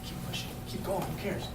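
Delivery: nearly whispered encouragement; hushed urging. Retold or imitated. Urging recruit to push on.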